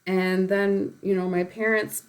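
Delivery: usual speaking voice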